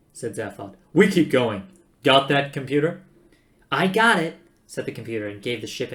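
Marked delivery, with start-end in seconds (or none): 0.9-3.0 loud, firm order; commanding. Acted. Zaphod orders the computer. 3.7-4.4 raised, louder perky greeting; chirpy computer. Acted. Computer answers Zaphod.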